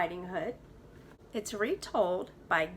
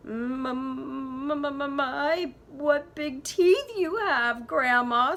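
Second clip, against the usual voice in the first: slow, stammering fright; frightened stutter; 5 semitones higher, 7 dB louder, slower than usual. Acted. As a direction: stutter it out, scared of the teeth.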